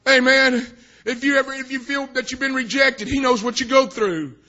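forceful, lifted pulpit voice; impassioned preaching. Real, not acted. Preacher to those who feel rejected, impassioned. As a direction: swell into rousing exhortation.

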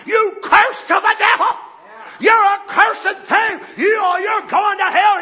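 high, loud hollered curse; raging mob shout; mock screaming curse. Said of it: "they were screaming and hollering".

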